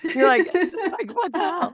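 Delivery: high, slow, laughing through the words; giggling at the odd question. Real, not acted. Asking guest a weird question, laughing.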